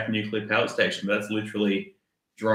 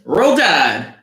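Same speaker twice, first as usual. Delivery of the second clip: high, loud mock Southern cheer; Southern accent; 6 semitones higher, 8 dB louder than usual. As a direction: holler it in a badly faked Southern accent. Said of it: "your phenomenal Southern accent skills".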